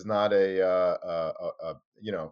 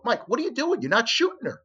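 much higher, disbelieving call; indignant question; 13 semitones higher, 4 dB louder than usual. Retold or imitated. Reporter to the photographer, much higher disbelief. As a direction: demand an answer, pitched way up. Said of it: "I go".

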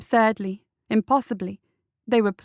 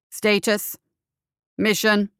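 mechanical voice; slower than usual. A narrator's reading. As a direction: interrogate like a cold robot. The construct questions him.

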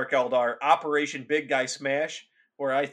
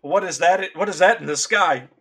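alarmed soldier; 3 semitones higher, 5 dB louder than usual. Acted. Soldier spots Chaos Spawn overhead.

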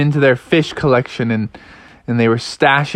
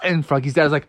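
ordinary delivery, nothing marked

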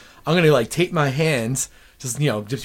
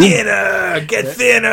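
loud, high hammed-up yell; mock curse shout; 7 semitones higher, 5 dB louder, slower than usual. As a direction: call it out like an overacted curse. Acting out shouted Thinner curse.

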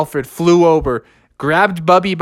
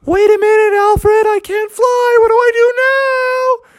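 very high, alarmed wail; frantic falsetto plea; panicked cry; 4 dB louder than usual. A narrator's reading. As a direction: panic in midair, pitch soaring. Bear realizes he can't fly.

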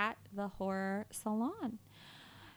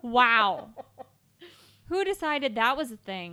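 loud disbelief; 2 semitones higher, 9 dB louder than usual. Real, not acted. Disgusted at meat product.

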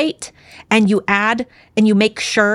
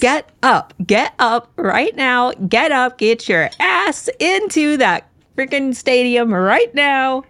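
mock yelling; 6 semitones higher, slower than usual. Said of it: "is yelling at Grace to get up".

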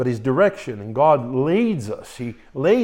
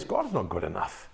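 low, soft sulky mutter; grumbling complainer; 8 semitones lower, 10 dB quieter than usual. Retold or imitated. The murmuring people complaining about God, low sulky mutter. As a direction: grumble it under your breath like a sulking people. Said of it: "they were simply saying this".

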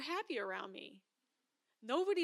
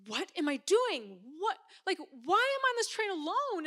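panicked inner voice; 7 semitones higher, 6 dB louder than usual. Retold or imitated. Panicking alone on the train.

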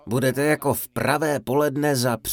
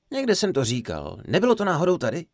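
normal talking voice

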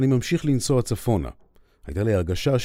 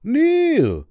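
much higher, loud call across a distance; shout; 5 dB louder, much slower than usual. Retold or imitated. Dan spotting Nir and shouting his name from afar. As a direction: yell his name from far off. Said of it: "אני שומע צעקה".

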